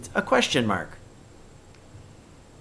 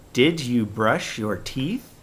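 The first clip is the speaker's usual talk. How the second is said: slow question rising on teeth; slower than usual. Shown as a demonstration. Teacher modelling a question to kids, rising. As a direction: ask it gently. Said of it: "We're asking a question".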